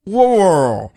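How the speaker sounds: WAR WORLD slow and run together; tongue-tied. Shown as a demonstration. Host playing up the running slip, tongue-tied. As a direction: blur WAR WORLD into one long mouthful of r's. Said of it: "we are going to have a, a counter Mogo here".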